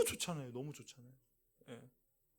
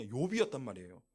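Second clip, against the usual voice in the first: usual speaking voice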